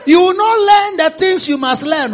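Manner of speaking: high, loud preaching rebuke; full-force preacher's rebuke; forceful rebuke. Real, not acted. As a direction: hammer the warning home.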